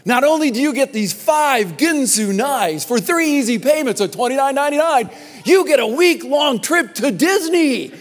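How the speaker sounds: loud, high infomercial pitch, building; home shopping host. Retold or imitated. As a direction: hype it like a late-night ad. Pitchman ad parody.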